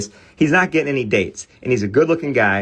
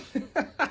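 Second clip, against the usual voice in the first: soft high laugh; high giggle; 9 dB quieter than usual. Real, not acted. Remembering his buddy's profile, he giggles. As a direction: giggle breaking through. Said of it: "I just cracked up".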